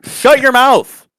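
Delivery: high, loud, drawn-out mock scolding; mock outburst. Guest playfully silencing co-hosts, mock yell. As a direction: jokingly yell it, stretching the words.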